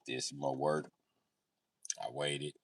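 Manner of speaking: ordinary talk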